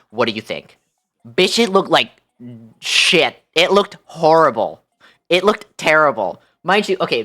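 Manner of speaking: ordinary talk, nothing marked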